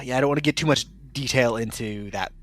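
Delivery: ordinary delivery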